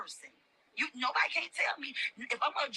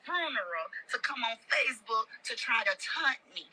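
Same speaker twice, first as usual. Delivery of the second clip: normal talking voice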